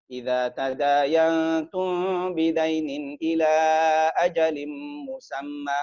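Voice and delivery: slow, drawn-out chanted recitation; slow melodic Quran chant. Acted. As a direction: recite the verse slowly, melodic.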